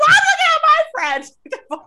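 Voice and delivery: very high, loud mocking echo; she's mocked, squeaky and loud; shrill laughing mock. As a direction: screech it back through a laugh. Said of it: "She's like".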